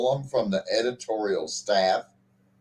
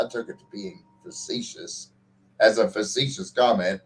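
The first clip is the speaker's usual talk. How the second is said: usual speaking voice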